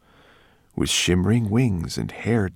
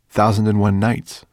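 louder repeat for a listener who missed it; raised-voice clarification; 3 semitones higher, 7 dB louder than usual. A narrator's reading. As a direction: say it again louder, to be understood. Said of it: "repeated Ben".

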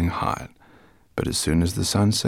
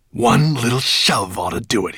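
ordinary delivery, nothing marked

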